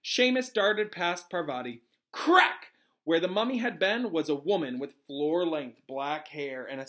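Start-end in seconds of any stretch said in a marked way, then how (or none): 2.1-2.7 high, loud burst; sharp exclaimed sound word; sudden crack effect. A narrator's reading. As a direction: punch it out like a whip crack. Narrator voices sudden magical crack.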